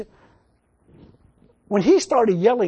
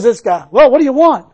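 booming, louder preacher's question; 5 semitones higher, 13 dB louder than usual. Retold or imitated. Preacher voices Jesus calling to the blind man, loud. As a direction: call it out loud to the crowd.

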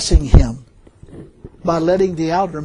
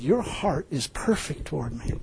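soft, understated divine voice; hushed retelling of God's words; 2 semitones lower, 9 dB quieter than usual. Retold or imitated. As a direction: quote God gently, well below speaking level. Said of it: "He said".

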